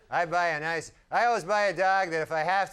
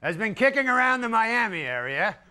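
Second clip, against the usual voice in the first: Ed McMahon intro; 4 semitones higher, 4 dB louder than usual. Retold or imitated. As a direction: announce the band like a TV host. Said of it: "Ed be going".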